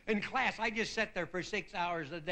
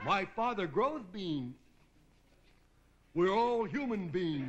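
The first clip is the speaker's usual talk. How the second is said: slow, dopey moron voice with a changed sound; slower than usual. Retold or imitated. Comedian playing the moron in the schoolroom joke, slow dopey voice. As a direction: drag it out like a dim kid. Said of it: "and the moron".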